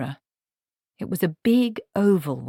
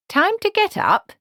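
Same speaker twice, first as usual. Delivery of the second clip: much higher and louder, bright wake-up call; unusually cheerful; 6 dB louder than usual. A narrator's reading. Granny wakes the princess, high and cheerful. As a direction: chirp it like a jolly alarm. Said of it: "in an unusually cheerful voice".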